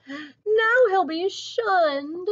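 high, very slow mock wail; crying out. A narrator's reading. Ruth dreads the bird's shunning, crying out. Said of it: "she cried".

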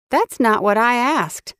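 a hint of impatience; pressing woman. A narrator's reading. McClintock presses him.

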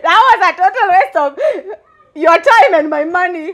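high, giggly plea for forgiveness; laughing apology. Real, not acted. Interviewer apologising to Calif Records for bad singing, laughing apology. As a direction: apologise through helpless laughter.

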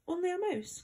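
high-pitched, offended cry of a little mouse; affronted exclamation, swooping high then falling; indignant. A narrator's reading. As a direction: echo it back in indignant disbelief, pitch leaping up. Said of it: "cried the little animal indignantly".

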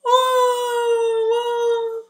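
high loud wail of Oh, oh; a little boy bursting into tears; child wailing in a story for kids; crying. Acted. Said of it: "Max started to cry".

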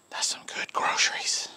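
soft unvoiced whisper. Husband retelling wife's whisper in his ear, whispering. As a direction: whisper it close in her ear. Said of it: "she whispered in my ear".

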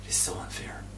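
whispered "It's so unfair"; hushed echo. Shown as a demonstration. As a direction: repeat it without voice. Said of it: "repeat after plain take".

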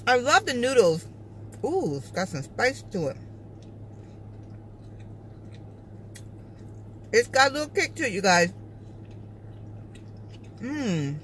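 words between chewing bites; talking while chewing. Real, not acted. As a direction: mouth partly full of noodles. Seen 0: Takes noodle bite at -9, closed-lip chewing between phrases.

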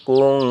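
কুং ending in a held nasal ng; গুন্না nasal ending. Shown as a demonstration. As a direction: hum the ng of কুং through the nose. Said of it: "ইকফার সহিত পড়তে হবে". (কুং নাকে টেনে গুন্না করে)

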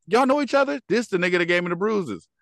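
high-pitched mock disbelief; retold startled confrontation. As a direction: act out her alarmed questions, pitch climbing high.

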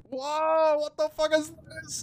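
high startled squeal; shocked yelp. Real, not acted. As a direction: squeal at the loot. Shocked at loot kit.

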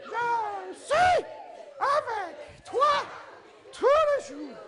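the women sing it high and slow; high sweet voice. Shown as a demonstration. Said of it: "avec une jolie voix suave".

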